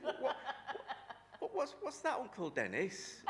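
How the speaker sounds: high, loud, laughing squeak of shock; shocked squeal. Retold or imitated. Boy asking Uncle Dennis about the film, laughing shocked squeal. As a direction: squeal it through laughter, scandalised.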